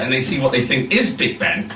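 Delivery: usual speaking voice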